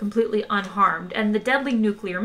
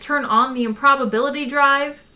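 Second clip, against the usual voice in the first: moderately raised, higher voice; shouting; 5 semitones higher, 4 dB louder than usual. A narrator's reading. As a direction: call the question out over the din. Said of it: "shouted Trillian".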